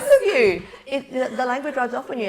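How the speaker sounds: loud, high, through laughter; laughing tease. Real, not acted. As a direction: call it out while laughing hard. Teasing amid shared laughter.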